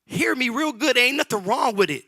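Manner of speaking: loud, high preacher's cry; shouted sermon delivery; full-force preaching. Acted. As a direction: preach it out to the congregation.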